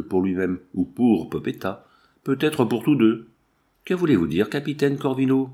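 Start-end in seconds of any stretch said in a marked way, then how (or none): none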